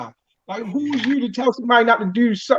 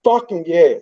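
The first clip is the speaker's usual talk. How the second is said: ordinary delivery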